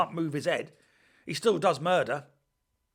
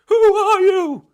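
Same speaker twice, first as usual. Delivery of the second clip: high, loud panicked plea; terrified high voice; 16 dB louder, slower than usual. Retold or imitated. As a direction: shriek it like a scared crook. Criminal's line in film scene.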